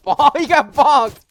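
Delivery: high, loud, stretched-out crowing; gleeful yell. Real, not acted. Player watching someone get hit, gleeful drawn-out yell. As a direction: crow it slowly at full volume.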